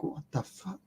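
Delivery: a breathless whispered what the fuck; whispered curse. Retold or imitated. Woken by a crack in the dark, whispered curse. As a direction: hiss it so nothing out there hears. Startled awake in hammock at night.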